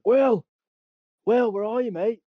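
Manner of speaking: high calling shout across the woods; shouting for a lost mate. Retold or imitated. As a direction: yell his name to find him.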